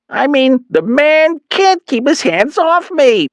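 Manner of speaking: high, slow mother's voice; Mom voice. A narrator's reading. Mother urging her son on the phone, high and slow.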